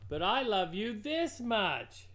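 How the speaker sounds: a father hare topping his little one; Big Nut Brown Hare. A narrator's reading. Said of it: "he said".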